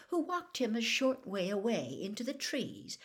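ordinary delivery

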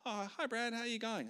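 high, chirpy imitation of a cheery hello; bright mock greeting. Pastor's wife greeting Brad in the staff room, imitated high and chirpy. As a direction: mimic a sugary sing-song greeting, pitched well up. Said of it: "she's like".

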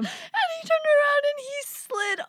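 high, teary laughter; barely holding it together; laughing while telling. Real, not acted. Laughing through the story.

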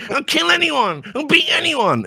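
high, squeaky mock boasting; angry little man. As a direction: imitate a tiny fighter's shrieked threats. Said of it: "And he's going".

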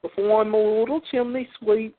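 high, hammed-up Cockney; Cockney chimney sweep. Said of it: "that's not it".

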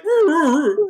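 high mock dog roo; playful high-pitched dog imitation; dog noise. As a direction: yip roo, roo, roo like a silly pup.

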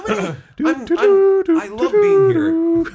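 high-pitched mock gushing; high squeaky voice.

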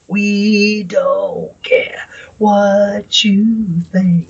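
slow, whispered growl of the chorus. Retold or imitated. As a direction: drag it out in a whisper.

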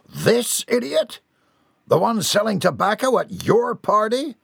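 high, indignant outburst; incredulous accusation. A narrator's reading. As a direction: accuse him with pitch shooting up. Father points accusingly at Jeb.

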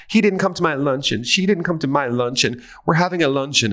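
high, prissy mock rich housewife; socialite voice. Host mocking Beverly Hills wives in a high snooty voice. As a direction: play a snooty hostess up high. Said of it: "Real Housewives, charity luncheon".

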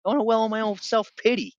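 very high-pitched mock outburst of protest; hammed-up self-pitying refusal; indignant retold rant. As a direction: whine it loudly, like a wronged young guy. Acted indignant refusal, wallowing in self-pity.

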